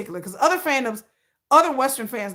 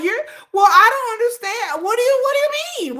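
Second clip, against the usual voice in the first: high, fast, whiny confused fan voice; squeaky rapid-fire mock fan; mocking flustered fan; 10 semitones higher, 5 dB louder than usual. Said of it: "And they were like".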